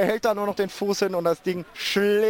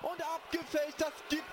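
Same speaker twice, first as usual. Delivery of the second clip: screaming goal commentary; 8 semitones higher, 17 dB quieter than usual. Real, not acted. Goal call on radio.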